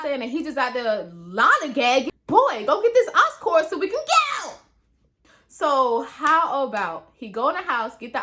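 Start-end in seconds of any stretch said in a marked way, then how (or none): 2.3-4.6 high, exasperated order; impatient scolding. Retold or imitated. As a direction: hurry him up, annoyed. Scolding him to hurry.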